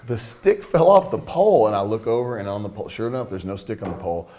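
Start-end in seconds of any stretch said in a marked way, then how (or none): 0.1-1.7 high, loud, slow disbelieving repeat; acted-out astonishment, drawn out; incredulous echo. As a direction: echo it back loudly in mock disbelief. His own incredulous echo of it.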